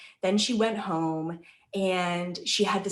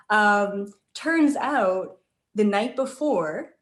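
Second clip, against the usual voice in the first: ordinary talk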